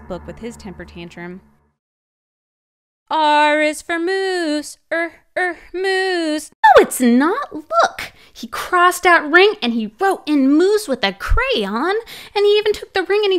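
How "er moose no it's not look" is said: usual speaking voice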